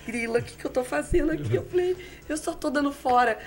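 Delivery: high, amused mock despair; laughing at her own blunders; embarrassed self-talk. (constrangida e rindo de si)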